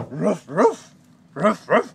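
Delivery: loud playful barking, ruff ruff; dog barks. Pretend. Daddy playing the dog, barking loudly at the child. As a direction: bark at the child like a happy puppy.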